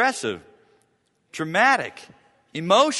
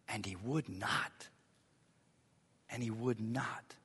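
hushed repetition; 4 semitones lower, 12 dB quieter than usual. Shown as a demonstration. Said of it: "repeated for emphasis".

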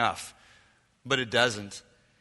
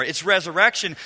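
ordinary delivery

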